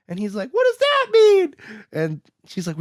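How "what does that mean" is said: very high, loud hammed-up yell; shrieked imitation of a frantic kid; mock outburst. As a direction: blurt it out as an over-the-top outburst. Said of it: "he has some outbursts here".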